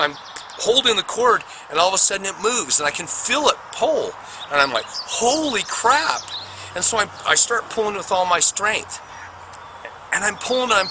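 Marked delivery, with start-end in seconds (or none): 4.9-6.2 astonished. Retold or imitated. Sudden shock as cord moves.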